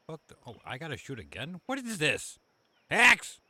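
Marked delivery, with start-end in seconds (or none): 1.7-3.4 sudden startled yell; surprised shout. Real, not acted. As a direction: shout it out in shock. Sudden shock at the game.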